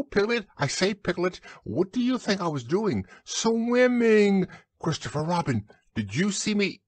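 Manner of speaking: high, bubbling little voice; excited squeaky Roo. A narrator's reading. Roo asks everyone about the swimming, squeaking excitedly. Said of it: "squeaked Roo excitedly".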